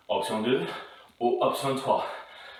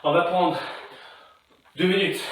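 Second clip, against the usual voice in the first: ordinary delivery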